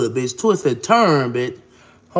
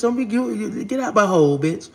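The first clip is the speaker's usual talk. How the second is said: ordinary talk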